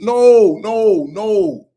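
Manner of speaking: loud, high, drawn-out no's; emphatic denial. Real, not acted. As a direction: push back hard, raising the voice. Emphatic rebuttal of false narrative.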